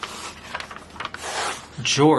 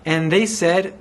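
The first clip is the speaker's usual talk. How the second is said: plain reading voice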